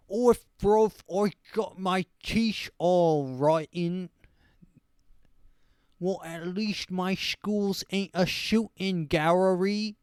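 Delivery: loud, high, slow mock-British voice; dumb British accent. Host mocking English people's bad teeth, dumb British accent. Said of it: "stupid British person just like".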